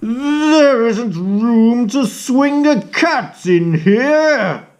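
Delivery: high, put-out complaining bee; indignant bee. Acted. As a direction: grumble like a cramped little bee. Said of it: "buzzed the bee".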